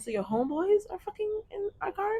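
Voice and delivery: high, incredulous question. Retold or imitated. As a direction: pitch it up in disbelief.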